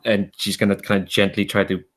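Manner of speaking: usual speaking voice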